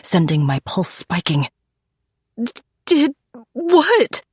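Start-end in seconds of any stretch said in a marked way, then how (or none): 2.4-4.2 high, stammering and terrified; frightened cry. A narrator's reading. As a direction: stammer it out in panic. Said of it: "I cry".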